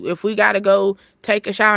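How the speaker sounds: usual speaking voice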